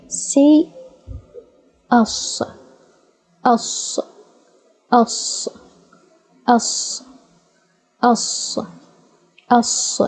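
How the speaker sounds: us, us, with a long breathy s; hissed s sound. Shown as a demonstration. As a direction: hiss the s of "us" steadily, air through closed teeth. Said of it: "try to pass air".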